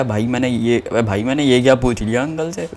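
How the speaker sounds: softly scolding himself; muttering at his own blunder; quiet inner regret. Retold or imitated. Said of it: "मेरे दिमाग में आया".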